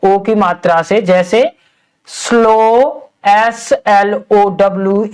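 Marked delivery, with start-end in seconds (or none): 2.1-5.1 each letter drawn out for the class; slow spelling demo. Shown as a demonstration. Teacher spelling a word slowly for pupils. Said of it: "इसमें last में क्या है?".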